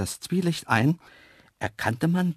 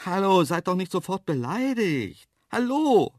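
high, pleading call ending louder; calling after them; 8 semitones higher, 5 dB louder than usual. A narrator's reading. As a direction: call into the distance to bring them back. Calling after departed antelopes. (bittend hinterherrufen)

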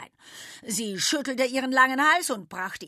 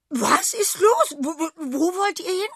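aghast shriek; 10 semitones higher, 10 dB louder than usual. A narrator's reading. Goat sees the animals leave, shrieking aghast. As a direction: shriek in disbelief. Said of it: "kreischte sie entgeistert".

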